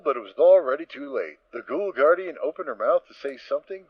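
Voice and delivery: ordinary talk, nothing marked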